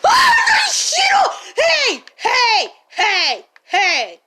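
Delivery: very high, loud shriek that settles into loud shouts; jump-scared yell; startled scream. Real, not acted. Startled by a zombie jumping off the railings, screaming.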